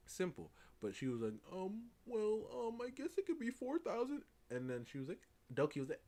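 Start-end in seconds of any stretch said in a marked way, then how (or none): 1.5-4.2 higher-pitched, hedging imitation of a hesitant seller; hesitant mock quote. Artist hedging on what it could cost, high mock-hesitant voice. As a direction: float the price timidly in a high voice.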